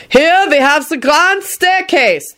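high, drawn-out announcement; bellowing tour guide. A narrator's reading. Guide cutting off an argument, high bellow. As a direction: proclaim it over the group. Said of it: "the tour guide bellowed hastily".

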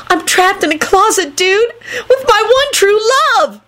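very high, gleeful lovestruck screech; happy screech. A narrator's reading. Kenny stuck in a closet with his love, screeching happily. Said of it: "Kenny screeched happily".

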